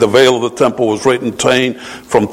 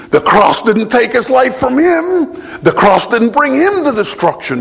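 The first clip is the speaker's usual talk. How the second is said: fervent preaching; 12 semitones higher than usual. Acted. Preaching at full force.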